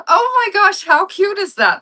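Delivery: high, loud gush; acted squeal over something cute; gushing delight. Retelling her delighted reaction.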